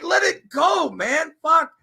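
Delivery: high shouted exasperation; shouting at the side chat; frustrated shout. Retold or imitated. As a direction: yell at the chatters to drop it. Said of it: "I'm like".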